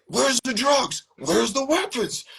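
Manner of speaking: high, pinched voice demanding answers; imitating a cop in a squeaky voice. Cops raid, repeated demand.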